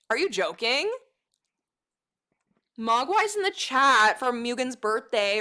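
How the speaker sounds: normal talking voice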